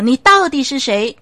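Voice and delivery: shouted, forceful question; loud final demand. Acted. The pastor shouts his last demand at the voice. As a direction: demand an answer at full force.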